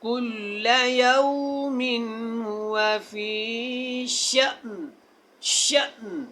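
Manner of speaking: slow chanted Quran verse; tajweed recitation. Shown as a demonstration. Teacher recites the corrected verse in slow chant. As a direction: draw out यौमिन melodically. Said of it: "teacher recites the verse".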